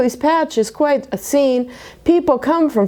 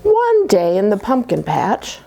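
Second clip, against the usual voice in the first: plain reading voice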